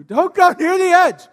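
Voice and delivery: loud, high warning call; mock warning shout. Preacher voicing the danger sign as a loud warning call. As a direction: shout the sign's warning at them. Sign's warning voiced loudly.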